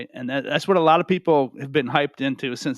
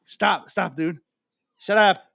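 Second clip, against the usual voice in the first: yelling at the cat; 5 semitones higher, slower than usual. Real, not acted. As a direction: snap at the cat to stop. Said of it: "when I yell at him".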